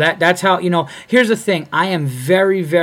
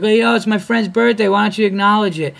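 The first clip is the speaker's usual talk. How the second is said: higher, louder demanding heckler voice; mock heckler's tone; 7 semitones higher, 5 dB louder than usual. Heckler demanding the comic acknowledge a friend's birthday, higher mocking tone. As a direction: imitate an indignant audience member, pitched up. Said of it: "she just had this tone".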